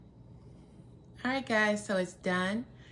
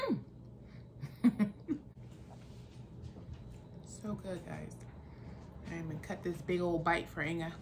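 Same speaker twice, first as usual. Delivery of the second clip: ordinary talk, nothing marked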